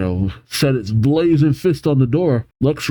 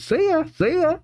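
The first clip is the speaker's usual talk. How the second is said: high squawky parrot echo, much higher and softer; parrot mimicry; 4 dB quieter than usual. Parrot repeats Pearl's goodbye.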